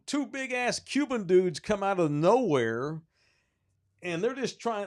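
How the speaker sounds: ordinary talk, nothing marked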